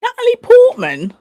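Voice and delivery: can't believe it's her; stunned "Natalie Portman?" with a high rising end; surprised. Real, not acted. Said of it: "I did not know".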